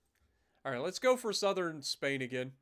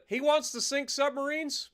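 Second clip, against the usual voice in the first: incredulous; 9 semitones higher, 5 dB louder than usual. Real, not acted. Incredulous at enemy sub hunter.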